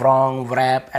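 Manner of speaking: "wrong, wrap" begun with vr, the W sounded; forbidden mispronunciation. Shown as a demonstration. Teacher predicting students' reading mistakes, mispronounced with vr. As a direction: say "wrong, wrap" as vrong, vrap. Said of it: "जो जो मना किए हैं वैसे ही".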